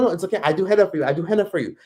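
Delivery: high, insistent vendor imitation with broken English; henna lady insisting. Imitating the henna lady refusing to take no, high and insistent. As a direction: keep pushing, high and repetitive. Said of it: "She stood in front of me like".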